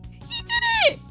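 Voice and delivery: very high, loud squeal of triumph; elated cheer. Pretend. Cheering Klutzy's success, elated shriek. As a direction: squeal it like a proud parent.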